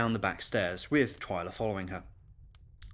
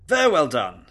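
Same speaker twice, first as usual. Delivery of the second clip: loud, higher congratulation; hearty guest; 4 semitones higher, 10 dB louder than usual. Acted. Guest applauds governess after fight, loud and hearty. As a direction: pronounce it as an impressed guest.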